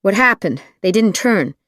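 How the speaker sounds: a restrained yell at the driver. A narrator's reading. Passenger yelling in the driver's ear as the car misses its turn. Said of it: "yelled practically in Skip's ear".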